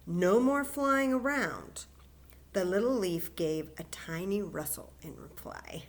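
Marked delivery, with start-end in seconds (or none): 0.0-1.9 stern. Acted. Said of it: "said Fletcher sternly".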